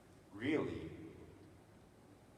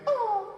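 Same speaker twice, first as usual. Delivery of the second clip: high, louder mock scream; pained yell; 6 dB louder than usual. Speaker voicing his own foot at the crowd, mock scream. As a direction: voice the foot's scream. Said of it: "my foot went".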